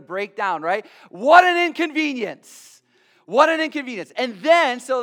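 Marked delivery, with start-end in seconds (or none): 1.1-2.7 loud, much higher mock lament; ironic exclamation. Real, not acted. Preacher to his church, loud ironic lament. Repeated sermon refrain. 3.3-4.1 loud, much higher mock lament; ironic exclamation. Real, not acted. Preacher to his church, loud ironic lament. Refrain said again.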